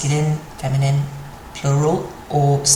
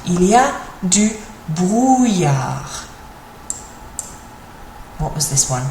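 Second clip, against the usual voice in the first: ordinary talk, nothing marked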